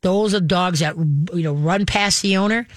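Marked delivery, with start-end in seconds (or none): none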